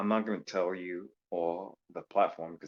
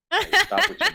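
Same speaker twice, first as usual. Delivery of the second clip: laughing; 13 dB louder than usual. Real, not acted. Laughing protest.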